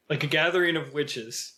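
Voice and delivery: usual speaking voice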